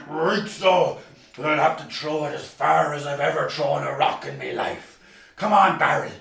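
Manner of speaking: lightly voiced burly strongman. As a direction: sound like a big strong country man. Strongman answers the children.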